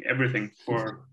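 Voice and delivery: usual speaking voice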